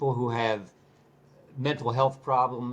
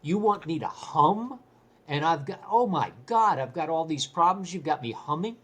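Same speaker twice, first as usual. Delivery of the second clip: higher, amused disbelief; incredulous retelling; 5 semitones higher than usual. Retold or imitated. Star player scoffing at being told to hum, amused disbelief. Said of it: "he bust out laughing and said".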